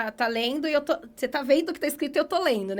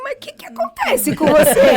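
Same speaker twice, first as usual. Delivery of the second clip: exasperated retold question; 6 semitones higher, 12 dB louder than usual. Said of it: "Aí eu falei assim".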